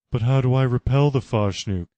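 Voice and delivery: a different, strained Laura voice; worried Laura. Acted. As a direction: plead for the answer.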